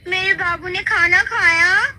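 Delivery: high, slow sweet coo; doting wife's voice message. Acted. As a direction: coo the question to her husband. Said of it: "message play हो जाता है". ([name] की धीमी ऊँची लाड़ भरी आवाज़)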